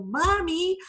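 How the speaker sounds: put-on high, loud little-kid voice; little child's voice. Chapter leader retelling a child's interruption at a meeting, little child's voice. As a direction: pipe up high like a small child calling out. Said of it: "you heard this little voice go".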